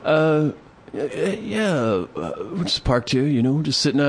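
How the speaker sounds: lightly voiced, stammering, dazed drifter; stammering drunk guy. Imitating the stammering truck guy.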